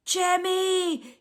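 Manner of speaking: high, loud yell across the dark. A narrator's reading. Martine calling Jemmy as she runs, loud high yell. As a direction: shout his name with all she has. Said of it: "yelled Martine".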